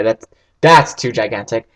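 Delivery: ordinary talk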